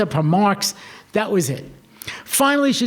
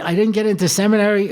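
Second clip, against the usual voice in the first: normal talking voice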